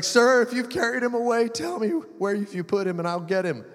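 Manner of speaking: high-pitched plea, easing down; acted crying plea. Crying Mary pleads with the stranger, acted tearful. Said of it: "And she's crying. She's like".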